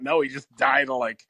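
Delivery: ordinary talk, nothing marked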